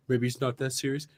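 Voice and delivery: soft, calming words to yourself; calming self-talk. Retold or imitated. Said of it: "I'm like".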